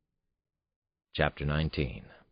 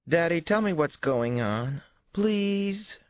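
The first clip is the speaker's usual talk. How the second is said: put-on high, pleading child's voice; begging little girl; 4 dB louder, slower than usual. A narrator's reading. Little daughter begs her father for the secret, pleading. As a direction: beg Daddy like a little girl praying for an answer. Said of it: "Johnny clasped her hands in prayer and begged".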